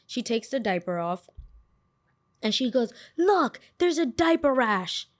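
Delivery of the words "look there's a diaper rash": high, slow, drawn-out mock accusation; accusing mother. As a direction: imitate an outraged mother pointing out a fault. Mimicking mother's accusing discovery.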